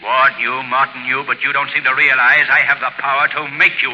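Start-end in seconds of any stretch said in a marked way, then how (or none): none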